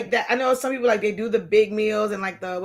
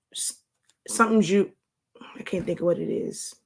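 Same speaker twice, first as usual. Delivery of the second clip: whisper; 3 semitones lower than usual. Real, not acted. Searching memory, unvoiced.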